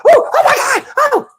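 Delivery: very high panicked wife's cry; high-pitched mock hysteria; hysterical screaming. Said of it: "People are screaming".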